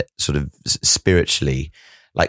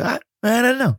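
very high put-on De Niro voice. Co-host mimicking an interviewee dodging a question, De Niro impression. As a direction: mimic the actor's verbal shrug, pitched way up. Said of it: "that thing De Niro does".

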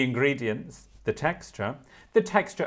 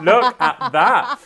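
laughing; 7 semitones higher, 11 dB louder, slower than usual. Real, not acted. Amused by court ruling.

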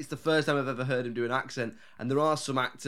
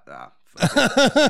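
loud, higher, drawn-out give-up; mock giving up; 10 semitones higher, 10 dB louder, slower than usual. Comic voicing actor dropping his accent, mock exasperation. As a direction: throw in the towel, loudly and slowly.

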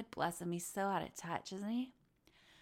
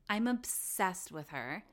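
normal talking voice

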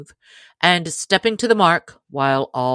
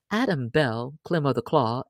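usual speaking voice

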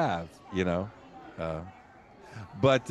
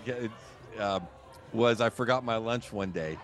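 ordinary talk, nothing marked

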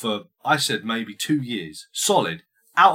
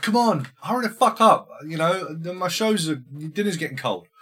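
higher, lightly mocked nagging wife; 7 semitones higher than usual. Wife calls her husband back to dinner, mock nagging. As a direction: call him in impatiently as a mock wife.

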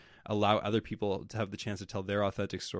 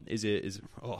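voice crack; 3 dB quieter than usual. Real, not acted. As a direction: voice cracking mid-word. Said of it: "Bad voice crack".

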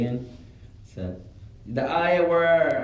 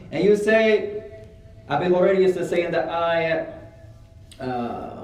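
ordinary delivery

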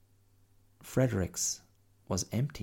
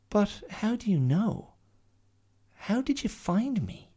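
put-on high young girl asking, amazed; little girl voice; 14 semitones higher, 3 dB louder than usual. Lost girl Mia questioning the bear, high and eager. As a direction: pitch it up like a small girl. Mia's lines in story.

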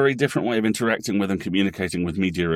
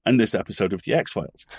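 ordinary talk, nothing marked

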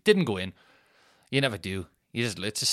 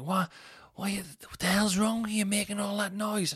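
retold hungover shouting; 11 semitones higher than usual. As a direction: imitate a grumpy woman woken up, voice pitched way up. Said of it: "can you not shout at us".

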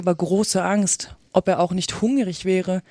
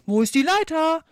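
high-pitched call over a confused crowd; 8 semitones higher than usual. A narrator's reading. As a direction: call out for missing gear, pitched up. Workers shout in confusion. (aufgeregt und hoch gerufen)

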